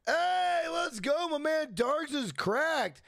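high, excited cheer; hyped shout-out. Real, not acted. Streamer celebrating a new channel member, excited shout-out. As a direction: celebrate with drawn-out, high-pitched hype.

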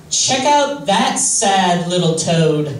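slow, drawn-out retold put-down; deliberate insult. Guy at a party looking over at him, deliberate insult. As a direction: spell out the mockery word by word, slowly.

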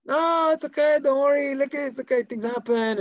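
insistent reassurance. Acted. Man soaked with tea, over-insistently reassuring. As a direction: wave the apology away too eagerly.

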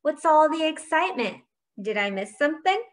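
high opening, quick finish; chirpy, innocent asking; cheerful. A narrator's reading. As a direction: breeze in cheerfully with a question. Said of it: "he called out cheerfully".

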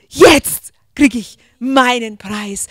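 slow, loud triumphant inner voice of a champion; slow proud champion's thought; triumphant winner. Acted. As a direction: savor it like a gold medalist. Olympic winner's thought on podium.

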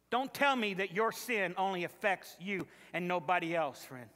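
ordinary talk, nothing marked

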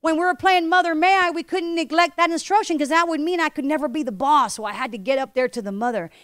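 ordinary delivery

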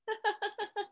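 high, soft run of giggles; playful giggle. Pretend. Reader leads the children's giggle game, giggling. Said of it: "giggle like yourself".

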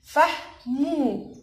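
very slow "فحمو", each syllable held; sounded out slowly. Shown as a demonstration. Teacher building a word with children, slow. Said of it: "عليها سكون عشان وقفنا عليها".

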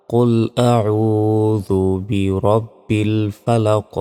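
very slow, drawn-out tajweed recitation; measured melodic model recitation; Quran recitation. Shown as a demonstration. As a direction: recite with long held vowels.

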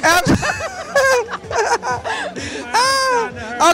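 high breathless laughter; laughing. Real, not acted. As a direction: crack up and laugh helplessly. Laughing fit ends interview.

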